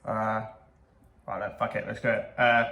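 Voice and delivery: ordinary talk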